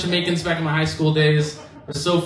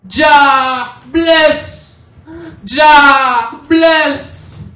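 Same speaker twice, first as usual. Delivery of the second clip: high loud shouted blessing to the sky; joyful Jamaican yell; 9 semitones higher, 8 dB louder, much slower than usual. Retold or imitated. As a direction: shout it up at the sky like a street preacher. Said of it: "screaming into the sky".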